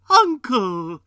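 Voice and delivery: very loud, high plea; pleading. Acted. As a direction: cry out to stop him. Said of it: "pleaded the nephew".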